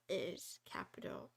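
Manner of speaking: whispered aside under the breath; a soft unvoiced trailing whisper. Real, not acted. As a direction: drop to a whisper as you finish reading. Trails into whisper while reading.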